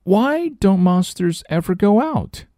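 put-on high child voice; doubtful little girl, high.

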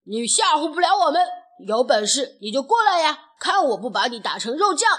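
loud, fast, big-mouthed dare; shouted challenge. A narrator's reading. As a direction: bellow a challenge at the enemy. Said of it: "大嗓门儿也接着叫阵". (大声叫阵)

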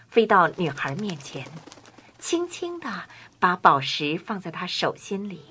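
usual speaking voice

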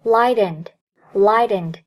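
"light and" run together as "lightened"; light linking. Shown as a demonstration. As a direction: say "lightened" as one word for "light and". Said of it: "可以轻微连起来读作".

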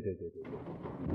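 soft breathy whisper of agreement. Real, not acted. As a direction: whisper it, slow and low. Agreeing under breath aside.

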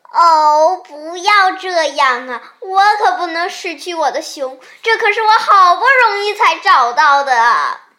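loud, high, pleading lament; distraught wail. A narrator's reading. The rabbit fears losing its hard-found bear, wailing loudly. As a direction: wail in hammed-up dismay.

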